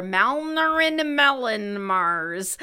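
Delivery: Malnerin Melon Mars stretched out as one drawling garble; caller's garbled name. Retold or imitated. Retelling a phone caller asking for someone, Malnerin Melon Mars in a slow garble. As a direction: imitate the caller running Malnerin Melon Mars together, drawn out. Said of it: "the guy on the line asked for".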